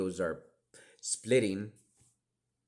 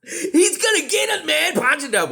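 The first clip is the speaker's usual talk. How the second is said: much higher, louder, laughing; laughing mock friend; 13 dB louder than usual. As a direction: cackle the friend's warning. Friends' voices, laughing loudly.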